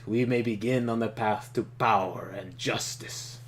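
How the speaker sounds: loud, stately warlord reading; Trakov voice. Acted. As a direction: give it weight like a villain's speech.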